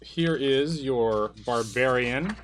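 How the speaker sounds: normal talking voice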